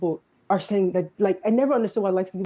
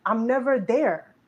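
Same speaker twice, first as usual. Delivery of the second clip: ordinary talk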